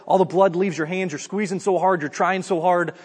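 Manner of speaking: ordinary talk, nothing marked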